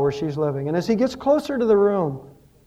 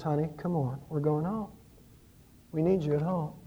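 much softer, tender coaxing; gentle husband; 3 semitones lower, 10 dB quieter than usual. Acted. Husband to his weeping wife, soft tender coaxing. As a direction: quietly coax her home.

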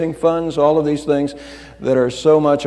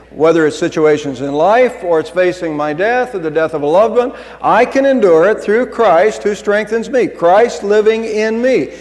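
normal talking voice

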